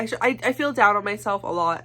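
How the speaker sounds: ordinary delivery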